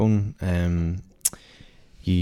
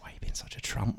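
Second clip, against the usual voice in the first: whispered, much softer put-down; scornful whisper; 3 semitones higher, 11 dB quieter than usual. Retold or imitated. Calmly dismissing the drunk man, whispered and soft. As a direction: hiss the insult under your breath. Mocking a threatening man quietly.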